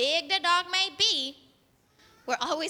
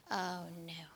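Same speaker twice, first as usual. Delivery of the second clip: low, hushed worry; much softer and lower, playing a scared boy; acted dread; 9 semitones lower, 13 dB quieter than usual. Boy hears something behind him.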